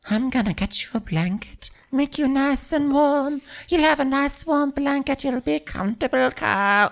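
exaggerated high impression of a doting crewmate; Natasha. Natasha fussing over injured Kyle with a blanket, high-pitched mock coddling. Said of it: "you have Natasha, who's like".

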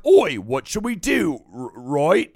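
lightly put-on Cockney British accent; mock Cockney accent between mates. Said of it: "We always talk in British accents".

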